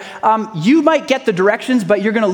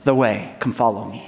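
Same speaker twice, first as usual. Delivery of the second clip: tender invitation; 7 semitones lower, 3 dB quieter than usual. Acted. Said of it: "He said".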